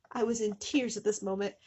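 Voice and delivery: ordinary delivery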